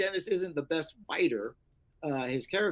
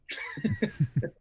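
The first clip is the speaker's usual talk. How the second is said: high burst of laughter. Real, not acted. Laughs at being recognized.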